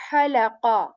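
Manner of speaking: halaqa begun with breath pushed from mid throat; halaqa with airy ha. Shown as a demonstration. Teacher contrasting the airy word. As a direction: push air first, then halaqa. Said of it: "we say".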